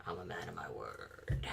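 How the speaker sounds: slow, breathless villain whisper; creepy whisper. Acted. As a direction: hiss a sinister promise. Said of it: "Just do the whole show like that".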